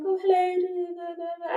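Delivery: high, put-on work-phone greeting; mum's phone voice. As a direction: mimic mum answering the work phone in her put-on voice. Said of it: "answer the telephone in a certain way".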